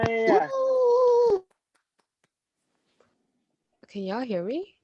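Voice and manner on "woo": high excited whoop; loud cheering woo. Real, not acted. Poet hypes the crowd.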